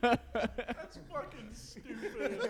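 laughing through the words; wheezing with laughter. Real, not acted. Laughing at the punchline.

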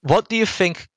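ordinary delivery

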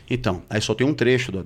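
ordinary delivery

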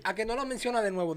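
soft retold challenge; understated girlfriend's provocation; quiet dare. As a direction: drop the volume for a sly quoted dare.

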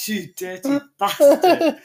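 high, slow laughing outrage; laughing insult. Real, not acted. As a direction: squeal it through a laugh.

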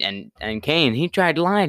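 ordinary talk

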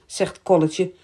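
ordinary delivery

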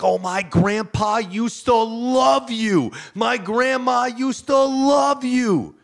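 high, slow, loud imitation of adoring fans; imitated fan yelling with drawn-out words; gushing fans. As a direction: stretch it out in a hammed-up cry. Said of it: "people come up to me like".

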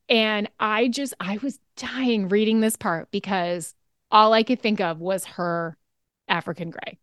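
usual speaking voice

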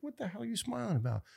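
high, fast disbelieving retort; incredulous. Retold or imitated. He asks his smiling friend John, incredulous. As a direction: throw it off in mock disbelief. Mocking quote of himself to John.

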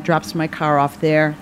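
ordinary talk, nothing marked

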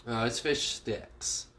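ordinary talk, nothing marked

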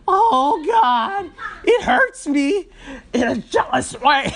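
high, whiny mock voice with laughter, stretching the words out; mocking the jealous coworker. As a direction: ham up a jealous coworker's fake whining, giggling through it. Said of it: "what I want her to do".

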